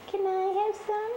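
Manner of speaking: voicing a kitten.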